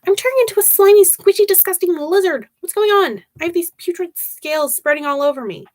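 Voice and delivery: high-pitched, disgusted outburst; panicked rant. Acted. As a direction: blurt it out in mounting distress. Angel rants about turning lizard.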